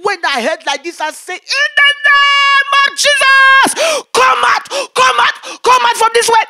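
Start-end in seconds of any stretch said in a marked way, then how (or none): 1.4-6.4 loud, high exorcism shout; fervent retold command; commanding prayer. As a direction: drive the spirit out with every breath. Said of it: "I was praying with all my strength".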